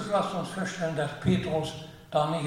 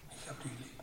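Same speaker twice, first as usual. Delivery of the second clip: soft; 3 semitones lower, 16 dB quieter, faster than usual. Acted. Peter answers third time.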